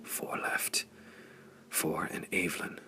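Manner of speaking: hushed, breathy whisper; soft whispered count of the enemy left. A narrator's reading. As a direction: whisper it close, under the fighting. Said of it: "Flame whispered".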